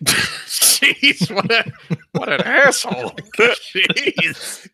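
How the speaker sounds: high, laughing disbelief; reacting to a friend's cruel joke, laughing. Real, not acted. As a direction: laugh through the words in shock.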